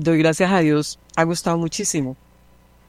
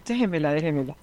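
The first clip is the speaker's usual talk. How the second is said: much softer, hushed repeat; soft coaxing; 8 dB quieter, faster than usual. Retold or imitated. Teacher quietly asks for the crying girl, hushed and soothing. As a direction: murmur it to calm a crying child.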